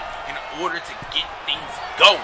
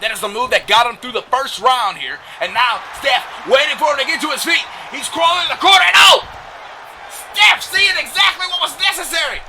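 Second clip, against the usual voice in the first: rising, louder wrestling commentary; excited play-by-play; 9 semitones higher, 6 dB louder than usual. Real, not acted. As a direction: build from lively call to high shout.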